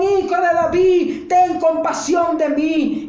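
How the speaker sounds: a blind man shouting to Jesus for mercy; shouting with all his strength. Acted. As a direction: cry out with all your heart. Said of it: "clamaba con toda su fuerza".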